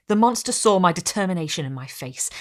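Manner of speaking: plain reading voice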